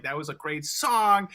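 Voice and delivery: imitating an enthusiastic compliment, drawn out; slow, gushing praise with a lift on "song"; retold fan's enthusiasm. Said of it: "he was enthusiastic".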